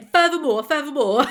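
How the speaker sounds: put-on loud, punchy South London accent; mock-stern South London accent. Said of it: "South London's always like".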